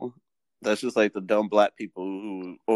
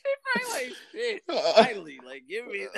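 laughing; 13 semitones higher, faster than usual. Retold or imitated. Imagining the old woman's reaction.

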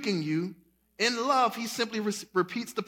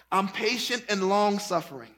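laughing God voice; 2 semitones lower than usual. Retold or imitated. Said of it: "God is like".

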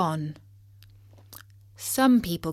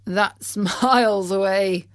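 loud, amused scoff; laughing driver; 5 dB louder than usual. A narrator's reading. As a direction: chuckle through the words. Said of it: "laughed the driver".

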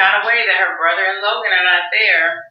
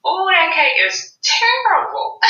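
horrified reaction; 12 semitones higher than usual. Retold or imitated. Tony reacts to cake picture.

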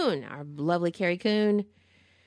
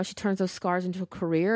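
usual speaking voice

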